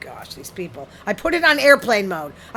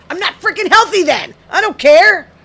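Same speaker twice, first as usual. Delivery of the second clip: loud, high-pitched protest; defiant outburst; 8 semitones higher, 9 dB louder than usual. Pretend. Answering an article that says it isn't healthy, defiant yell. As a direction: burst out in loud, indignant defiance.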